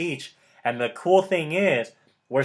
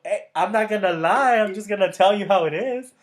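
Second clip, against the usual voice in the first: usual speaking voice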